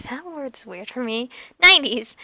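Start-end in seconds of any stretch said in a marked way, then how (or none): 1.6-2.2 loud "Nineties", firmly falling; supply "Nineties" loudly from across a room; calling across room. A narrator's reading. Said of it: "Miguel says from across the room".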